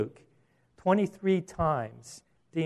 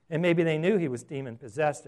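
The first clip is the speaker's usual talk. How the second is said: ordinary talk, nothing marked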